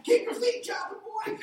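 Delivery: high, loud football-coach holler; coach's yell. Retold or imitated. Dad yelling coach-style at son pushing the mattress. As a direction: bark it like a coach at practice.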